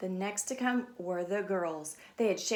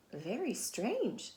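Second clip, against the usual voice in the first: slow, dubious, drawn out; slow wondering mom; slow puzzled; 3 dB quieter, much slower than usual. Acted. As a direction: linger on the doubt. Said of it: "said her mom".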